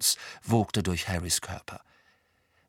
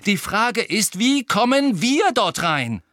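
loud, high, slowing for emphasis; angry bellow; 10 semitones higher, 7 dB louder than usual. A narrator's reading. Harry demands a way into the Ministry, shouting. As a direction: hammer out the question impatiently. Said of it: "brüllte Harry".

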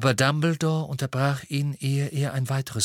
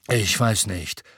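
ordinary delivery, nothing marked

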